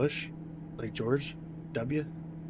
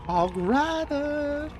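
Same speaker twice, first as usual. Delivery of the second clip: long drawn-out high Hog Rider yell; mimicking; 4 dB louder, much slower than usual. Gamer yelling the Hog Rider's catchphrase in a drawn-out high voice. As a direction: stretch it way up like the game's battle cry.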